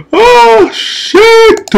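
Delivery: startled yelp. Real, not acted. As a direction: blurt it out in sudden fright. Sudden boss attack in game.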